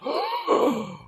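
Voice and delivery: a sharp, high imitated gasp; audience gasp. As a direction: gasp like a shocked crowd. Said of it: "everybody in the audience is like".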